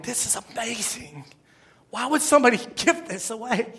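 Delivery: soft, moved disbelief, close to crying; hushed, choked-up amazement, retold; imitated near tears. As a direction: act out being overwhelmed by a gift. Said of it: "I'm about to start crying".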